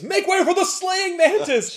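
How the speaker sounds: grand high proclamation, slowed down; heroic announcement. Retold or imitated. Eric bursting into the fight, announcing his hero name grandly. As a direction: announce yourself like a big hero.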